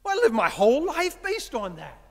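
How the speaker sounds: loud, high, distraught protest; hurt, pleading with his father; upset outburst. Retold or imitated. Grown son protesting to his dad, loud and upset.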